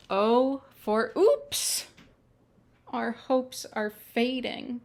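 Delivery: bright, lilting picture-book reading; reading to children. Acted. Storyteller reads the alphabet book to children, lilting. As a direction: read each letter like a treat for little ones.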